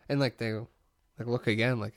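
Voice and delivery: ordinary talk, nothing marked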